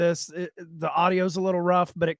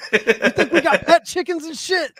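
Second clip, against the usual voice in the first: much higher, louder caricature of a brash wrestler; mock wrestler voice; 9 semitones higher, 5 dB louder than usual. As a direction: ham up the wrestler's trash talk. Hosts imitate Briscoe promo.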